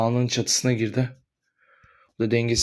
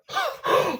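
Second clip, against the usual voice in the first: sharp gasp; 4 dB quieter than usual. Acted. Quarrel climax.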